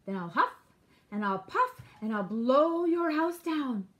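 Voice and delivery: loud, building wolf bluster; storybook villain huffing up; threatening wolf. A narrator's reading. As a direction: swell loudly through the threat.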